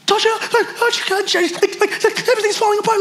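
high-pitched hammed-up freak-out; mock panic. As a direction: play a spouse venting in a panic, voice way up. Acts out a panicking spouse.

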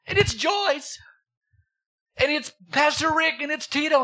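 high-pitched, delighted announcement of names; excited reveal. Acted. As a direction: reveal each name like a prize winner. Excited reveal in God's guessing game.

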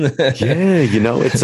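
high, breathy words through laughter; laughing. Real, not acted. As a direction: still cracking up at the joke. Right after laughing at joke.

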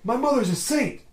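a hammed-up news anchor; mock movie character. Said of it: "he's like".

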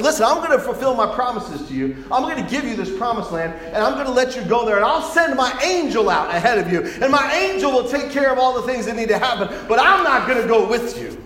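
loud, high-pitched divine decree; forceful preacher voicing God; emphatic declaration. God lays down terms to Moses.

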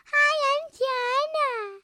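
very high, slow singsong introduction; Jana. Shown as a demonstration. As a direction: show off a loud, stretched little squeal of a greeting. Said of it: "You have to sound like me".

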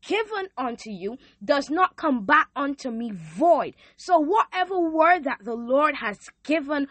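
rising preacher cadence. Real, not acted. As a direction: preach it like a sermon. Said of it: "why you sound like a preacher".

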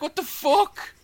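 very high squeaky disbelief; high-pitched incredulous mock; mock shocked squeak. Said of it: "He's like".

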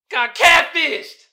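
loud, slow disbelieving cry; 1 exclaimed. Real, not acted. Telling viewers about the scam, loud and stunned. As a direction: belt it out in disbelief.

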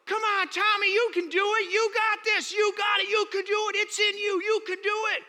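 high, loud shouted encouragement; cheering crowd. Retold or imitated. Kids shouting up to Tommy on the pole, loud and high. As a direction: cheer him on from the ground.

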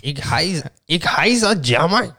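halting, drawn-out mock German; German accent. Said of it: "Do German".

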